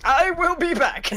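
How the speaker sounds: high, slow dramatic promise; hammy determined vow. Retold or imitated. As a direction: declare it like a movie hero. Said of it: "Baku's like".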